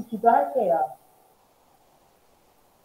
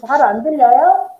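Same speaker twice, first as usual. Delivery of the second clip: much louder, raised voice; to someone hard of hearing; 12 dB louder than usual. Real, not acted. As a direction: speak up over a bad connection. Caller still can't hear.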